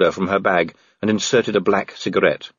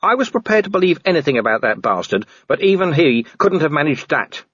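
pitched-up, exasperated watchman; a hint of an angry Vimes; 8 semitones higher than usual. A narrator's reading. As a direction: push back at her, exasperated.

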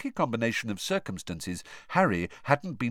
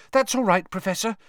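gasped; 11 semitones higher, 4 dB louder, faster than usual. Acted. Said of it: "Hermione gasped".

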